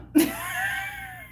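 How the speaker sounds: high squealing laugh; real high-pitched cackle. Real, not acted. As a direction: crack up at your own fib. Laughs at her own retold lie.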